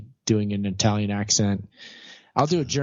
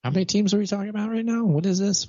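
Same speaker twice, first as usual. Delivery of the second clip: mock confusion; 9 semitones higher, faster than usual. Real, not acted. Confused by talk of teams.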